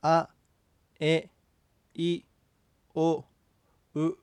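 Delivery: separate, clearly spaced vowels あ、え、い、お、う; model vowel pronunciation. Shown as a demonstration. Teacher modelling the vowels for the student, separate clear vowels あ、え、い、お、う. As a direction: say あ、え、い、お、う one by one as a model. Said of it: "発音してみましょう".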